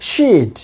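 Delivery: she'd with a held ee, "sheed"; long vowel. Shown as a demonstration. Teacher models she would contracted, long vowel. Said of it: "long sound উচ্চারণ হবে".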